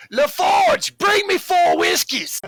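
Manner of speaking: very high, loud barkeep holler; yelling across bar. Acted. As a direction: bellow the order over to the robot. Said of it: "you see Jordy yell over to the robot".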